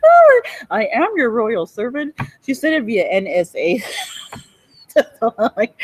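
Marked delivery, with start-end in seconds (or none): 0.0-2.1 giggling, hammed-up grovel; laughing mock servant. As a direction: overdo the humble servant, half laughing. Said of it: "Fucking idiots".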